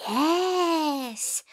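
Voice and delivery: high squeaky resolve; a small mouse making up his mind; determined mouse voice. A narrator's reading. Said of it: "thought the mouse".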